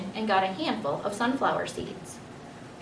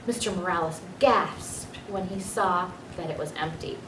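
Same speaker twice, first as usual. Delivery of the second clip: plain reading voice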